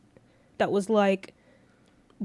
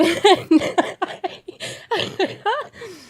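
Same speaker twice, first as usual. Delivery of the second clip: high burst of laughter; laughing at the story she just told; 12 semitones higher, 7 dB louder than usual. Real, not acted. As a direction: cackle at your own story. Laughs after the retold fight.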